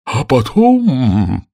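high, very drawn-out words; slow creature-voiced intoning; animated storybook turtle. A narrator's reading. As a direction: stretch it out with suspense. Mock Turtle continues, animated.

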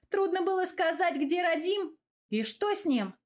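high-pitched exasperated scolding; starting to yell. A narrator's reading. Велора berates Гавр about missing Радим, yelling. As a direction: demand it, voice jumping up in reproach. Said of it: "начала кричать моя малышка".

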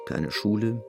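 ordinary talk

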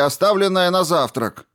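high, loud furious outburst; angry shouting. A narrator's reading. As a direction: yell it in his face. Eddy shouting in a quarrel.

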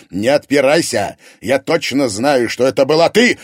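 loud, high barked accusation; father barking at wife. A narrator's reading. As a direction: bark it at her. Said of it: "рявкнул Егор".

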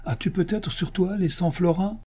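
high-pitched sly taunt; mocking question. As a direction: ask it high, as if the answer is obvious. Chancellor taunts a poor petitioner. (question moqueuse, voix aiguë)

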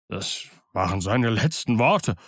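a mild fearful groan. Acted. Morgan groans to the crew in dread. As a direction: moan it in dread. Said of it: "stöhnte Morgan".

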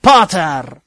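loud sharp bark of a name. Acted. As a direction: snap the name like a whip. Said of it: "гаркнул профессор".

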